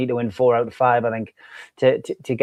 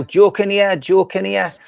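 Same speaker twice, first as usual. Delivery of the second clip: higher-pitched mock call of a name; imitating people shouting; 7 semitones higher, 4 dB louder, slower than usual. Guest voicing listeners shouting the answer, mock shout. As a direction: lightly mimic a crowd calling out. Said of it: "I can hear people shouting now".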